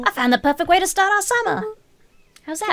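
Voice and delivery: put-on high eager boy; bursting with the big idea; excited kid voice. Rollo excited about plan.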